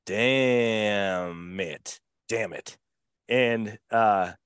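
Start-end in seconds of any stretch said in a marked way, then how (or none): 0.1-2.0 long stretched-out first word; admiring drawl. Retold or imitated. Husband looking at his wife getting ready, admiring drawl. 2.3-2.8 short, soft repeat; admiring aside. Retold or imitated. Husband looking at his wife getting ready, admiring aside.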